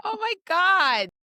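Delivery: high, loud, slow exclamation; shocked. Real, not acted. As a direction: stretch it out in disbelief. Shocked at the movie mixup.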